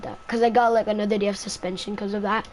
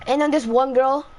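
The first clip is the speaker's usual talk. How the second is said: ordinary delivery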